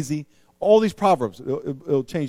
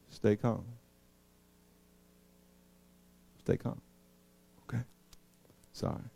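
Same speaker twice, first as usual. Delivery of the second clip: much softer and calm; 7 semitones lower, 13 dB quieter than usual. Shown as a demonstration. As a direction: say it gently to soothe. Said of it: "If someone gets mad".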